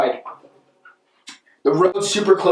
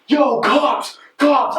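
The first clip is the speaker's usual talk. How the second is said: alarmed warning; 6 semitones higher, much slower than usual. Retold or imitated. Crowd warning about police.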